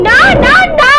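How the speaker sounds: screamed refusal. Acted. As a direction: shriek no at him. (চিৎকার করে না না না)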